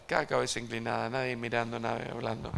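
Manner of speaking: gentle pastor's call to bow heads; altar call. Acted. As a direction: settle the room for prayer. Leads altar call prayer.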